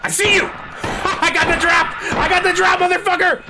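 high, laughing triumphant cry; gleeful taunting shout. Real, not acted. Player who got the drop on a monster, gleeful high shouting.

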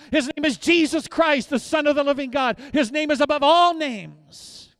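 loud, high, emphatic preaching; thundering proclamation. Real, not acted.

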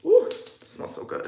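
airy whispered ooh of pleasure; breathy delight. Real, not acted. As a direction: breathe it out, savouring the scent. Smelling her new perfume.

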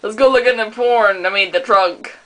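lightly hammed-up deep dad. Plays deep-voiced dad.

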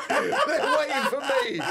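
slow, laughing mock of the reminder; laughing mock quote. Comic mocking the host's hint, slow and laughing. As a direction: tease him, laughing through it.

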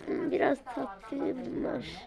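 slow, fading, half-whispered drowsiness; man sleepy after drinking the drugged water; drowsy. Acted. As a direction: drift off as the drugged water takes hold. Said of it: "uykum geldi".

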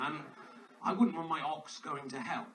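usual speaking voice

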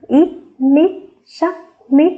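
slow, high, sung-out spelling of ít mít sắc mít; spelling aloud. Shown as a demonstration. Teacher spelling mít for young pupils, slow and high. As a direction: stretch each syllable of mít for the class. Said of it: "Chúng ta đọc theo cô nhé".

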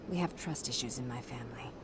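soft breathy whisper, half to herself; whispered aside. Acted. Confessing family trust issues to Shepard, whispered. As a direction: let the admission slip out in a hush.

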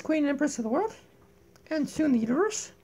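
normal talking voice

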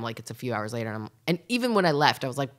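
usual speaking voice

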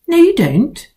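high, sharp retort; arguing girl. Acted. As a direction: cut him off, arguing. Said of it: "Lucilla argued".

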